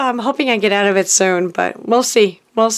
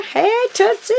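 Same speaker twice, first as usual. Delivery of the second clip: much higher, sing out the name; excited hello; 12 semitones higher than usual. Real, not acted. Greeting the sender of a surprise package, excited hello. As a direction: call out to a friend with delight.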